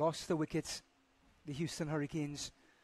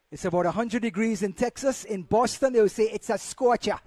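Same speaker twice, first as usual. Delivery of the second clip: cricket commentator; 4 semitones higher, 9 dB louder than usual. Acted.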